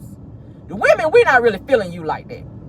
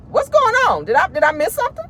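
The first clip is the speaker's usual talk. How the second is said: surprised; 9 semitones higher than usual. Real, not acted. Shocked by the news.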